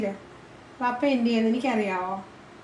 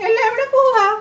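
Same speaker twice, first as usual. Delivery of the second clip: much higher-pitched playful question; teasing pet talk; 11 semitones higher, 4 dB louder than usual. Real, not acted. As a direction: tease the bird in a high cooing voice. Teasing the pet about vanished anger.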